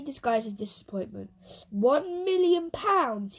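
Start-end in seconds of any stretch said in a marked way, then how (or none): 1.7-3.4 slow, high scornful scoff. Acted. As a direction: sneer at the amount like it's nothing. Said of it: "he scoffed".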